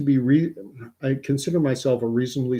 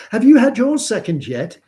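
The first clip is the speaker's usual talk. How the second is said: much higher, louder mock woman's voice; imitating Anu; 10 semitones higher, 8 dB louder than usual. Tennis partner quizzing him on his shot, high mock voice. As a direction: ask it brightly, expecting yes. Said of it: "And she said".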